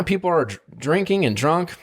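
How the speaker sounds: ordinary delivery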